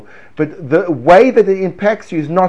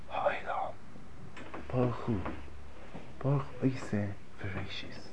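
soft prayer; 6 semitones lower, 21 dB quieter than usual. Shown as a demonstration. Rabbi showing students how prayer feels, hushed. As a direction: let it fade into a hushed murmur. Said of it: "my intonation, my voice".

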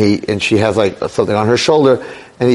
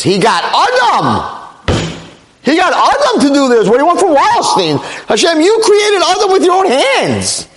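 high-pitched, climbing exasperated plea; impassioned protest; 14 semitones higher than usual. Real, not acted. Preacher pleading his case to Hashem, impassioned protest. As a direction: argue the case with mounting fervor.

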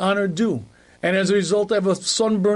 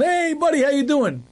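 much higher, bright mock hello to an old pal; hearty buddy greeting; 9 semitones higher than usual. Husband hugging his old-country buddy, hearty high greeting. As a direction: greet him like a long-lost friend.

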